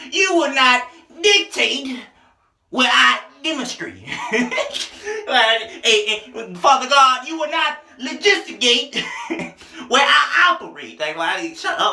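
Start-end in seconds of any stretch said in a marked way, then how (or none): 0.1-4.0 every word pushed high and loud, slow; preacher emphasis. Retold or imitated. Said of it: "put so much emphasis on one word". 6.0-11.0 high, loud, slow preaching, stress on not; preacher emphasis. Retold or imitated. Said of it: "put so much emphasis on one word".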